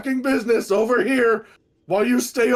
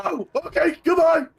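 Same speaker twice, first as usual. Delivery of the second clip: high panicked shout; panicked yelp; 9 semitones higher than usual. Real, not acted. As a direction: yelp and flee in terror. Fleeing a sea monster.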